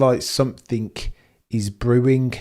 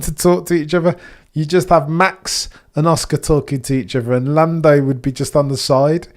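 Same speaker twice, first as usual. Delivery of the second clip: excited rant; 6 semitones higher, 6 dB louder than usual. Real, not acted. Excited rant about awkward podium.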